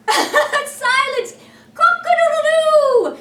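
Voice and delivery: shrieked cock-a-doodle-doo, high and loud; loud crow. Pretend. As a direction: crow like a proud rooster at full voice. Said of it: "Let's hear you crow like Peter Pan".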